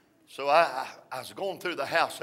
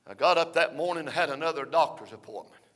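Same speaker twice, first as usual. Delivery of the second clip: normal talking voice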